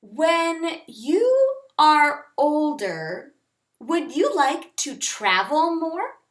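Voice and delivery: slow, clear example read; example sentence. Shown as a demonstration. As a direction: read it out slowly for learners. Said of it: "Like you could say".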